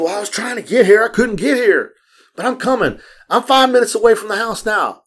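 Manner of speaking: high-pitched mock protest from a late friend; imitated excuse-making. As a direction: plead your excuse in a put-on high voice. Woman making excuses for being late.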